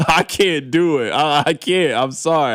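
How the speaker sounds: ordinary delivery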